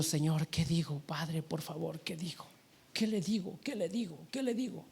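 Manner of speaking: soft, pleading inner prayer, retold; acting out a panicked private prayer; hushed desperate prayer. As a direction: plead under your breath, repeating the question.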